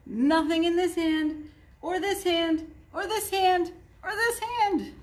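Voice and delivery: a showman climbing to a big reveal; building magician patter. A narrator's reading.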